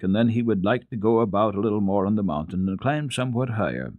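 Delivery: usual speaking voice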